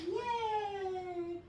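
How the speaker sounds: high, loud, slow gushing praise; shower the dog with delight; excited praise. Real, not acted. Praising dog after a success.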